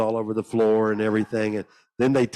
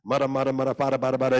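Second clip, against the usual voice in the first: imitated tongue syllables; 4 semitones higher, much faster than usual. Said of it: "words I could say".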